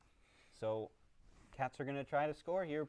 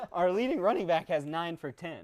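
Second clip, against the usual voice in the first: laughing; 3 semitones higher, 8 dB louder than usual. Real, not acted. Laughing at own team's stats.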